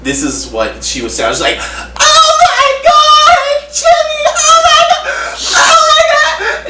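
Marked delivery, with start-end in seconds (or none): 1.6-6.7 much higher, louder mock wailing shriek; blood-curdling squeal. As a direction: shriek like her child just died, but hammed-up. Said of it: "this blood-curdling squeal".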